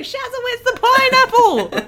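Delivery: high, loud laughing call; mock neighbour call. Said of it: "Everyone will be like".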